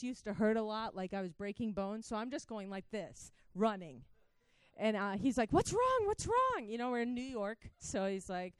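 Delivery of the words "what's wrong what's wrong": high, urgent imitation of alarm; alarmed stranger. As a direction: act out a startled passerby, pitched up. Alarmed stranger meets crying woman.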